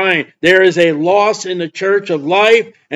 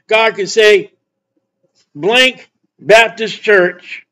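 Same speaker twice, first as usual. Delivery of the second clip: impassioned, accusing sermon; emphatic preacher in full flow; forceful preaching; 5 semitones higher than usual. Real, not acted. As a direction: hammer each phrase at the congregation.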